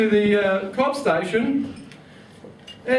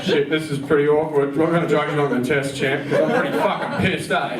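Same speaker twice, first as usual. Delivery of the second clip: put-on lower drunk reply; 7 semitones lower than usual. His drunk self quoted.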